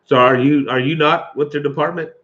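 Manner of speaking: ordinary delivery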